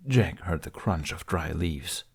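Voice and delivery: usual speaking voice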